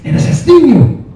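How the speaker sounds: put-on dad's Mexican accent. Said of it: "wicked accent".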